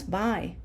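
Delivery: "by" with the English gliding vowel, rhyming with eye; "by" said the English way, as in goodbye; English reading. Shown as a demonstration. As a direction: misread Spanish bi as English "by". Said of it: "Our English brain would look at that".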